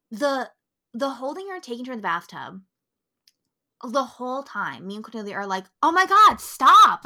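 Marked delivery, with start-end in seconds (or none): 5.8-7.0 loud, high, pleading stop; imitating shouting at the movie; yelling at screen.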